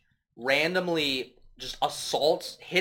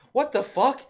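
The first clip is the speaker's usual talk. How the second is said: mock shocked exclamation; 11 semitones higher, 5 dB louder than usual. Voiced character exclaiming.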